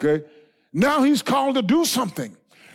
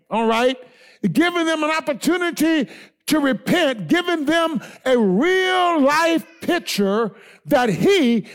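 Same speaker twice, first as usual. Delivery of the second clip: raised, ringing preacher voice, slowed for weight; fervent preaching; 5 semitones higher, 4 dB louder than usual. Acted. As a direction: preach it out to the congregation. Preacher building to full force.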